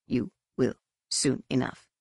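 tag withheld. A narrator's reading. Cryptic answer to exasperated Jason.

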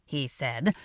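ordinary delivery, nothing marked

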